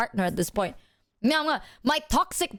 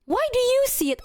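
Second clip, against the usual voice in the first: imitating therapist; 12 semitones higher than usual. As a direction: squeak the question like a sly therapist. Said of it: "my therapist went".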